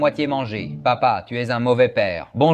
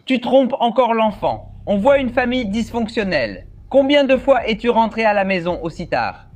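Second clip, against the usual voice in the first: accusing parent; 7 semitones higher than usual. Acted.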